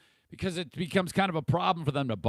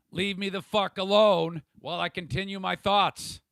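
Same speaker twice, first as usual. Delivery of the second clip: louder, snapping at the chat; real annoyance; 5 semitones higher, 6 dB louder than usual. Real, not acted. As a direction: tell the chat off, fed up. Telling the chat off.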